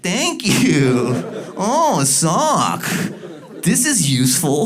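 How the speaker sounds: high, slow mock thankful; fake gratitude. Owner fakes thanks to the dog for a sock. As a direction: pretend to be pleased. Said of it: "you have to pretend".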